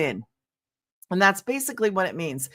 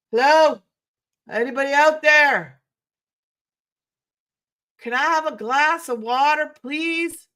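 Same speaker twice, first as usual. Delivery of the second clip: higher, louder call to someone off in another room; 6 semitones higher, 4 dB louder than usual. Real, not acted. Host calls off-camera to her family for water, loud. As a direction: holler for a glass of water. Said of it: "I don't wanna scream at you guys".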